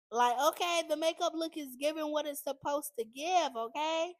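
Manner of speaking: real giddy excitement; feeling herself, excited. Real, not acted. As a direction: hype yourself up over your makeup. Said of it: "I'm getting too happy".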